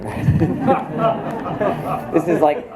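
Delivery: laughing at herself as she rambles. Real, not acted. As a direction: chuckle through the words, self-amused. Laughing at her own rambling.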